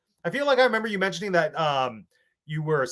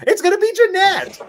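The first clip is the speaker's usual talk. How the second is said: much higher, louder game-show reveal; big announcement; 9 dB louder than usual. Pretend. Host revealing the first guest, a high loud announcer call.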